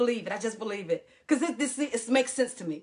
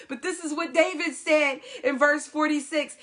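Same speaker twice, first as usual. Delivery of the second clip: normal talking voice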